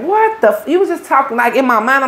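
cut-off exclamation jumping way up high; sudden disbelief, voice leaping high; shocked. Retold or imitated. She is shocked at the man in the bathroom, high startled cry. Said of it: "So I'm like".

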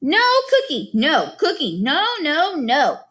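put-on high, wailing little-kid refusal; a toddler who won't be calmed; toddler tantrum. A narrator's reading. Boots refusing the cookie Gregor offers, toddler tantrum. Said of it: "way past the point of being pacified".

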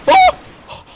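sharp high yelp; pained yelp. Real, not acted. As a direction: cry out as you die. Player goes down.

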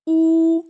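У held long, high and loud; long drawn У. Shown as a demonstration. Teacher models the vowel sound for children, a long held У. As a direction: sing out a long steady У. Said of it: "давайте вимовимо його".

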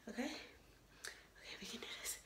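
whispered pep talk; whisper to self. Real, not acted. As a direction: psych yourself up under your breath.

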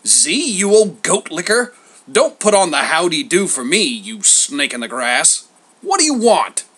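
put-on loud, sneering old grouch. A narrator's reading. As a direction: sneer at an old rival's phony welcome. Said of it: "Homer sneered".

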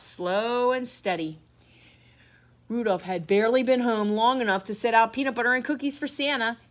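plain reading voice

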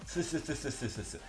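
stuck repeating ce with a lisp; lisping stammer on ce, s as th. Real, not acted. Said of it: "Je zozote".

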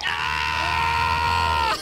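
drawn-out harsh scream; long panicked scream. Real, not acted. Player attacked in hallway, long scream. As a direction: scream it out and hold it.

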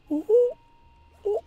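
high, loud little hoots; high "ooh, ooh". Real, not acted. Player reacting to the fight, high hooting oohs. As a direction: hoot it out high and sudden.

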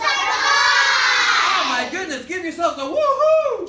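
thrilled teacher shouting praise; excited praise. Real, not acted. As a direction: cheer them on with delight. Excited praise after right answer.

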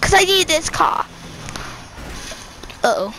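ordinary talk

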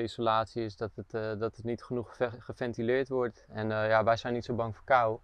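ordinary talk, nothing marked

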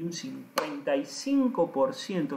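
slow, punched "cincuenta y cinco por ciento"; emphasis on CINCUENTA Y CINCO. Real, not acted. Coach stressing the key figure to trainers, emphatic "cincuenta y cinco". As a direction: hammer out "cincuenta y cinco" slowly, with a clap. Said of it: "aplaudí para en-, hacer énfasis".

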